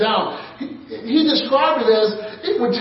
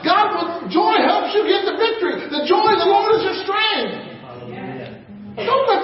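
higher, shouted proclamation; preaching shout; 8 semitones higher than usual. Real, not acted. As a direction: belt it out to the congregation. Preacher exhorting congregation.

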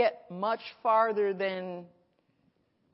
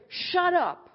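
loud, higher shout of shut up; shouted rebuke; 10 semitones higher, 6 dB louder than usual. Retold or imitated. Preacher shouting shut up at the devil's voice. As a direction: bark it at the devil. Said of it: "you would have heard me start talking".

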